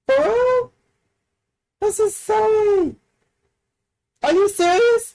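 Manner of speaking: high, loud incredulous squeals; can't believe the damage numbers; amused disbelief. Real, not acted. Shocked at game damage numbers.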